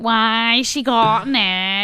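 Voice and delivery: drawn-out Scouse impression, slow; slow mock Liverpool voice; slow Scouse accent. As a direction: savour each word in a Scouse accent. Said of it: "in a Scouse accent".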